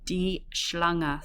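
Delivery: "Schlange" opening with "sh", then a clear L; "Die Schlange", SCH as sh and a crisp L. Shown as a demonstration. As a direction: keep the L in "Schlange". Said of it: "Make sure you keep that L in there".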